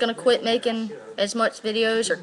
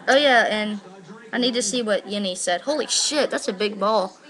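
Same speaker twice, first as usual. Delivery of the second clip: ordinary talk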